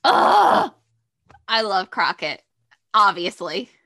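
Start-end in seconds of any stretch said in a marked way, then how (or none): 0.0-0.7 loud high delighted groan; a swooning ugh; excited groan. Real, not acted. Groaning at Crockett's name.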